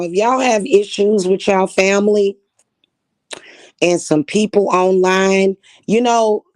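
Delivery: loud rant. Real, not acted. Angry rant at family.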